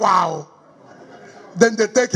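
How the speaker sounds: ordinary talk, nothing marked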